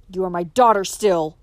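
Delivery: loud furious father; father storms at his defiant daughter; stormed. Acted. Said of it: "stormed Adam".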